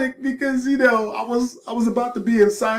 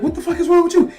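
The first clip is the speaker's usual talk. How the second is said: loud, higher mock retort; mock shout; 6 semitones higher, 5 dB louder, much faster than usual. Teller snapping back at his friend, loud and higher. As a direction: throw it back at him loudly. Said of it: "And I'm like".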